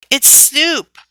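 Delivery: put-on high boy's call. Little boy calling after his running brother, high call. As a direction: call it across the common. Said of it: "called out Freddie".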